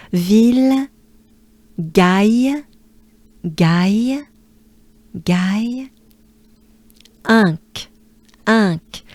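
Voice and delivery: Ville, Gaille, Inc said apart, each repeated; slow syllable drill. Shown as a demonstration. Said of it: "Let me break it down".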